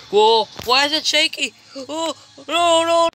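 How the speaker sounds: loud, high alarmed cries; rattled by the shaking; scared shout. Real, not acted. The airship shakes.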